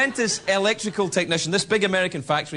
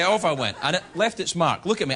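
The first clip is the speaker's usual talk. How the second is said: ordinary delivery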